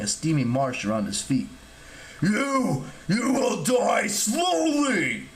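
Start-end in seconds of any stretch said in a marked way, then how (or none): 2.2-5.3 drawn-out, high-strained monster shout; huge villain voice, stretched words; menacing giant. A narrator's reading. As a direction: roar the threat slowly. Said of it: "the giant promised".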